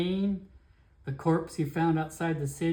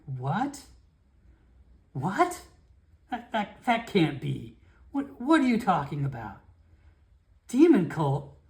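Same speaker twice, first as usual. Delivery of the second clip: stammering, rising disbelief; can't believe what he hears; shocked disbelief; 2 semitones higher than usual. Acted. Guard Felix hearing of a demon cult, stammering shock.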